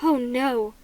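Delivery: plain reading voice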